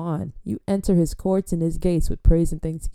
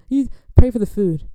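loud, higher acted order; exasperated interruption; 4 semitones higher, 7 dB louder than usual. Host interrupting an over-fervent grace, loud mock exasperation. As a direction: cut in loudly on the praying.

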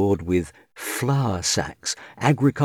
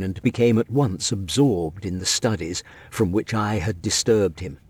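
plain reading voice

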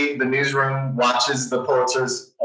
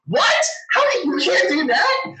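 high, incredulous and laughing; can't believe what just happened; stunned disbelief; 13 semitones higher than usual. Retold or imitated. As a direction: voice jumping way up in shock.

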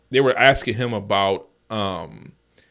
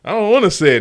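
whiny Arnold; 10 semitones higher, 4 dB louder than usual. Retold or imitated. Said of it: "and he was like".

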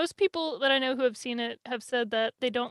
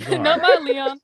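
laughing mock outrage; 3 semitones higher, 7 dB louder, slower than usual. Echoes the fan parody.

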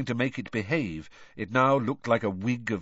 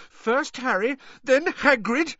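high-pitched, indignant counting off; shrill rage; 5 dB louder than usual. A narrator's reading. Hermione lists the reporter's victims, enraged. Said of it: "shaking with rage".

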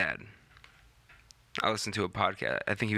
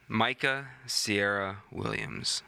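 slow drawn-out name; much slower than usual. Acted. Savoring the full name.

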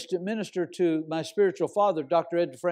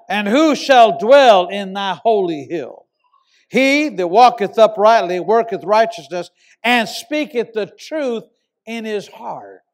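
loud, slow, solemn reading of the psalm; scripture reading; 8 dB louder, slower than usual. Acted. Preacher to his congregation, reading scripture loud and slow. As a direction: preach the verse out to the hall.

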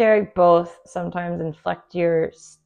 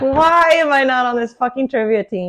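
higher, mock-outraged lament; exasperated complaint; 6 semitones higher, 4 dB louder than usual. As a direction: replay your exasperation at being left out. Retold frustration at trivia game.

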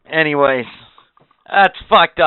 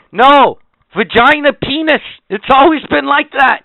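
higher and louder, words hammered; heated outburst; 8 semitones higher, 4 dB louder than usual. Real, not acted. Host ranting against the gender option, loud. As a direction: rant it, banging each word. Heated rant on the birth-certificate topic.

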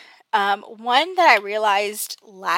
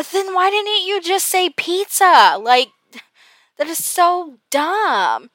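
high, louder exasperated protest; exasperated retort; 9 semitones higher, 5 dB louder, slower than usual. Retold or imitated. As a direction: retell a fed-up comeback, pitched way up. Retold exasperated retort to husband.

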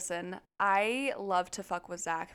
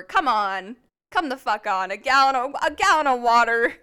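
put-on louder and louder, fed-up boyfriend; mock exasperated yell; 3 semitones higher, 9 dB louder than usual. Imitating Zach fuming about the spilled water, loud exasperated. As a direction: build the outrage, hammering "gallon".

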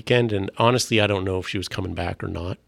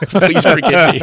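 typical Canadian apology; 8 semitones higher, 11 dB louder than usual. Retold or imitated. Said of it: "typical Canadian".

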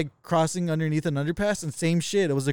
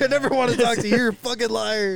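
mock outrage; 7 semitones higher than usual. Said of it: "You're like".

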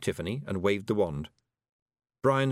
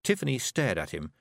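usual speaking voice

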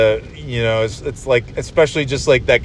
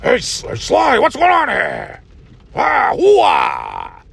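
hammed-up hollering screen legend, high and rough; over-the-top rasping actor's shout; Al Pacino; 8 semitones higher, much slower than usual. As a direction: bellow it and stretch the hoo-ahs. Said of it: "He just shows up doing that".

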